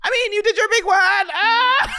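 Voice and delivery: very high, loud shrieking laughter; laughing hysterically while teasing. Real, not acted. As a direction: scream with laughter at your friend. Cracking up at co-host's accent.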